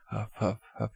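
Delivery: mock panting in three short puffs; imitated huffing. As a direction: mimic a climber puffing. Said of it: "He's, like, out of breath".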